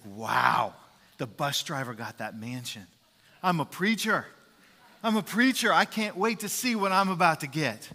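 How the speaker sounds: a preacher who can't wait for his reward; giddy anticipation, voice climbing; excited preacher. A narrator's reading. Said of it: "he's getting excited".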